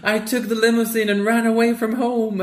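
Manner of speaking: put-on high child's voice; little girl voice. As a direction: say it like a small proud girl. Child Grace's line voiced.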